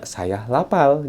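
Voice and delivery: put-on a light Chinese accent, "saya lapal" with L in place of R. Teacher contrasting a light Chinese accent with Japanese. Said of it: "Kalau orang Cina".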